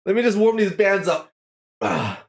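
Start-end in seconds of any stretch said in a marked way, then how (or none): none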